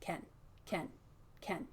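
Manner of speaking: "Can" with a clipped short vowel; shortened vowel. Shown as a demonstration. Teacher repeats the quick form for the quiz. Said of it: "said them with a shortened vowel".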